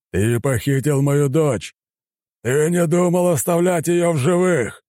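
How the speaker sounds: slow, high-pitched raging accusation; furious bellowing. A narrator's reading. Admiral raging at his daughter's kidnapper, bellowing slowly.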